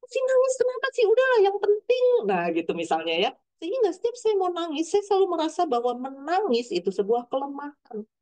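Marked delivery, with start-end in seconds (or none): 0.1-2.0 dismissive parent. Retold or imitated. Parent dismissing child's crying.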